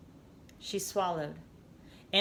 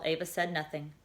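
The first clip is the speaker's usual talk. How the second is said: ordinary delivery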